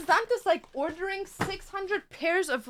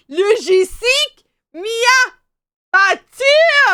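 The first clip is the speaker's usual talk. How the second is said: slow, louder, dragged out, ending in a shout; mock slow speech; 7 semitones higher, 15 dB louder, much slower than usual. Delivery guy slowly repeating himself to her, mock slow loud. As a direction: spell it out slowly like to a foreigner. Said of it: "started, like, speaking slow".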